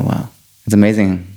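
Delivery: ordinary talk, nothing marked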